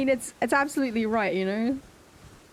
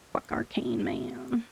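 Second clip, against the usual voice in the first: hushed, breathy curse under breath; whispered curse; 6 dB quieter, slower than usual. Real, not acted. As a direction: whisper the swear to yourself. Muttered curse at stat.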